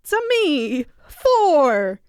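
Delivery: high, stretched cartoon call; hammed-up video game plumber voice; Mario. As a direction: call it out high, then let it fall. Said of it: "do it in a Mario voice".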